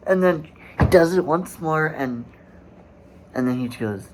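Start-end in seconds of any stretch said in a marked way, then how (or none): none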